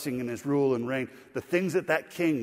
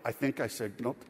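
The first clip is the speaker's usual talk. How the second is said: softer, lower second thought; doubtful aside; 3 semitones lower, 6 dB quieter than usual. Acted. Driver doubts his own signaling, doubtful aside. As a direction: back off it quietly. Backs off the first claim.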